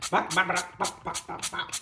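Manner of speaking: playful spray noises, bop bop; silly spraying sound effect. Acted. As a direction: voice each spray like a little pop.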